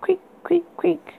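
high breathy creak whispered. A narrator's reading. Creaking sound effect voiced.

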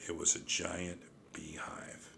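soft, breathless whisper. A narrator's reading. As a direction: hush the dreadful reveal. Dreadful reveal in narration.

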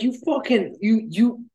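high-pitched acted sputtering; retold incredulous scolding; flustered outrage. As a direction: sputter in comic indignation. Rick berates the pilot.

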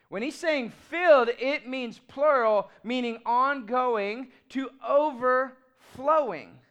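slow emphatic sermon stress; emphatic preaching. Acted. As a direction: lean on each key word slowly.

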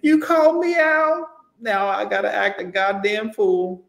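hammed-up high-pitched tantrum; mock screaming lady. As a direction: shriek at the cashier like a Walmart lady. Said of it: "She was screaming".

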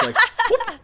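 high, loud slipping whoop; cartoonish whoop. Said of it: "that cartoonish".

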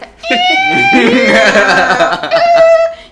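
big loud laugh bursting out; loud burst of laughter. Real, not acted. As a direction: laugh out loud, high and hard. Laughing at own joke. (gargalhada alta e aguda)

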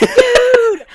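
high, loud, slow panicked wail; scared stiff by the moving can; freaked-out cry. Retold or imitated. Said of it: "I was like".

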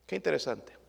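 normal talking voice

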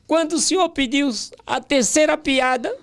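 high-pitched, hammed-up whimpering excuse; mock tearful pleading. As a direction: plead with the boss in a comic crying voice. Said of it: "já fui chorando". (choramingo fingido, voz bem aguda)

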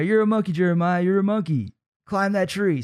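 a big brother teasing his little brother up a tree; older brother egging on. Retold or imitated. Older brother coaxing kid up tree.

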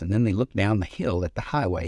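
ordinary delivery

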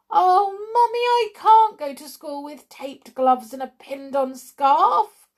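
put-on high, loud child's wail. A narrator's reading. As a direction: wail in protest to a parent. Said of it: "wailed Pam".

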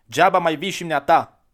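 put-on a small boy cutting the stranger off; boy's blunt voice. Boy bluntly turns stranger away.

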